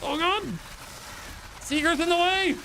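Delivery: high frantic cries; squealing in-game panic; panicked yelling. Real, not acted. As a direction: panic as the path gets blocked. Panicking as enemies block path.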